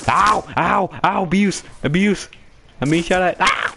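high pained yelps; man down shout. Real, not acted. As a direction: yelp and cry for help while shot. Getting shot in game.

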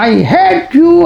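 high, drawn-up mock yell of I hate you; retold shout from the hill. Angry girl yelling at the hills, a retold high shout. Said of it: "అని అరిచిందండి".

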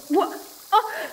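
sudden high startled cry; startled gasp. Acted. Explorer startled by a sound in the vines, sudden cry. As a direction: jump at the movement. Something moves in chamber.

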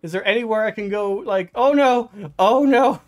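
from worried to frantic, loud and high; building panic. Real, not acted. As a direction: scramble for a way out, voice climbing. Panicking under attack.

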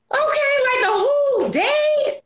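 very high, slow dismayed squeal; hammed-up exasperated shriek; mock dismay. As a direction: squeal over the ruined day. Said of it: "And I'm like".

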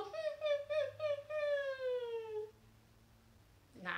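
high whimpering hmm like a puppy; puppy whine. Shown as a demonstration. Teacher showing the kids a puppy's whine, high whimper. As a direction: whine high through the nose like a sad pup. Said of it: "try your best puppy whine".

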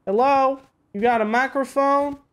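put-on southern accent. Player checking if neighbors have a microphone, southern accent. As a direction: call over to them in a southern accent. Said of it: "talk in my southern accent".

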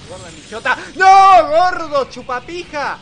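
furious shout. Real, not acted. Yelling at the game.

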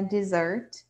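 Dessert stressed on the second syllable, de-ZERT. Shown as a demonstration. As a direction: model Dessert with the weight on the end. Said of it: "a tônica é a segunda parte da palavra". (Dessert com a tônica na segunda sílaba)

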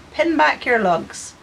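slow, high "Pin back your lugs" in broad Ulster Scots; Ulster Scots command. Shown as a demonstration. Artist telling viewers to listen up, slow and high, in broad Ulster Scots. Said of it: "if I want you to listen, I'll say".

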